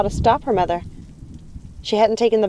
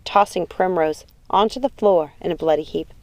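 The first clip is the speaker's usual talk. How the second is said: ordinary delivery, nothing marked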